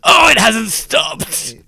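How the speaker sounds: loud, slow, exasperated outburst; annoyed shout. Retold or imitated. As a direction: yell it out in frustration. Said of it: "That's me getting really annoyed".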